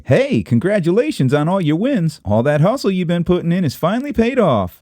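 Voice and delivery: congratulating acquaintance; supportive onlooker. Retold or imitated. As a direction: cheer them on brightly. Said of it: "when they say".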